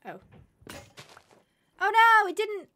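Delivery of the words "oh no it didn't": loud, high dismayed yelp; sudden alarm at a game mishap; surprised. Real, not acted. Game fails to fell tree.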